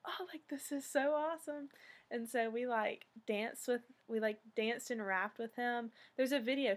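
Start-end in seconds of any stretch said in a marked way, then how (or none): 0.1-1.7 higher, bright gushing voice; thrilled gush. Retold or imitated. Fans to each other, high and gushing. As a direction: gush like a starstruck teen.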